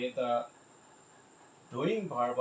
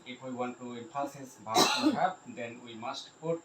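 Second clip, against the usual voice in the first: ordinary talk, nothing marked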